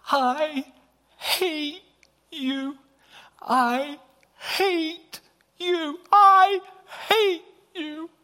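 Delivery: high, drawn-out, loud scream; shrieked with long, stretched words; screaming daughter. Retold or imitated. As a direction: imitate a daughter screaming word by word. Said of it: "She screamed at the top of her lungs".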